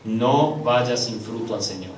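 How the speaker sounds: usual speaking voice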